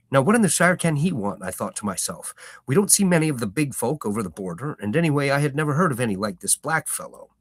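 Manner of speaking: storyteller reading a novel aloud; story narration. A narrator's reading. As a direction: tell it like a fireside tale.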